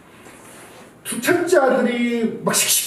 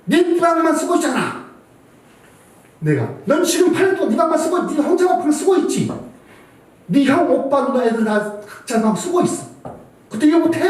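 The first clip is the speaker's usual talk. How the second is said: retold angry scolding; 9 semitones higher than usual. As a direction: replay the furious rant at the daughter. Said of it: "막 분노를 했겠어요".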